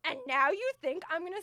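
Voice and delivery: indignant outburst. Retold or imitated. Retelling her outburst at the waiter, loud and indignant. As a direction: let the whole awful day spill out on the waiter.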